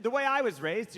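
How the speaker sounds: usual speaking voice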